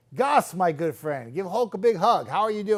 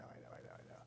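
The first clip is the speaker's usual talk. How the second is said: trailing off into a whisper; soft whispered resignation; 26 dB quieter, faster than usual. Real, not acted. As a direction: breathe the words, no voice. Fading repeated I know.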